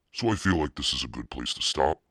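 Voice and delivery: ordinary delivery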